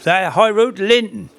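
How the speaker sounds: high-pitched Norfolk local, swallowing the end of Linton; Joe Skillings's Norfolk accent. Retold or imitated. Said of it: "coming from Baconsthorpe".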